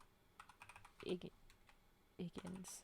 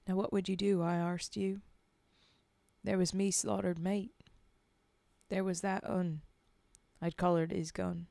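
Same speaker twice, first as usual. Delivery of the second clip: put-on Cockney-ish accent; 3 semitones lower, 7 dB louder than usual. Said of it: "that original accent".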